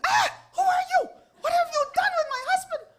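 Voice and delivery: very high, loud outraged screaming; screaming and yelling. Acted. Wife not recognizing her healed husband, screaming and yelling. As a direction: shriek accusations at a stranger. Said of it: "And she's screaming and yelling".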